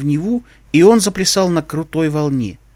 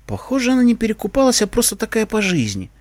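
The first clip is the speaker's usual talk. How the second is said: sighing; 6 semitones higher than usual. A narrator's reading. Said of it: "вздохнул я".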